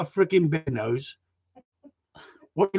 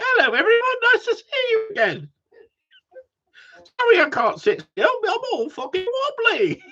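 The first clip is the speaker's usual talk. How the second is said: much higher squeaky voice, laughing through it; mock wobbly jelly voice. Imagining himself a jelly greeting viewers, squeaky and laughing. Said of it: "going like".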